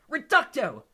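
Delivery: loud high shouted curse; bellowed spell. A narrator's reading. Five students blasting the shelves, bellowed spell.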